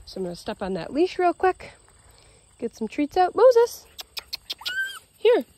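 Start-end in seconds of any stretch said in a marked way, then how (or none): 3.3-5.4 name called much higher and louder; calling the dog. Real, not acted. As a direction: call Moses to you.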